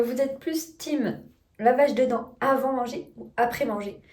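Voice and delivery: normal talking voice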